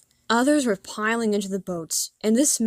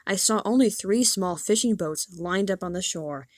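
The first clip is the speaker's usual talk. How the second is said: plain reading voice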